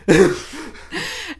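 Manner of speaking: high burst of laughter. Real, not acted. Laughing at Shark Boy joke.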